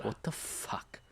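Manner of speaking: breathy whispered curse. Acted. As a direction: whisper it in disbelief. Whispered reaction.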